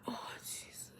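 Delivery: very soft, under the breath; dismayed whisper. Retold or imitated. Reacting to her unsent text, dismayed whisper. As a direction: whisper it like a retold private uh-oh.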